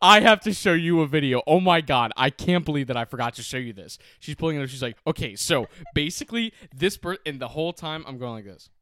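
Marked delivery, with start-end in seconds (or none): 0.0-4.0 loud, gushing imitation; Abigail overexcited. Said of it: "I get so excited". 5.1-7.2 higher, louder mock eagerness; Abigail overexcited. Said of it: "I get so excited".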